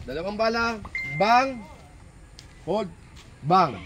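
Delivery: bala stressed, "bang" called out sharp; instruction demo. Shown as a demonstration. Instructor demonstrating drill timing to shooters, punched calls. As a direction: count out the shots with punchy bangs. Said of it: "ulitin ko, ha, instruction".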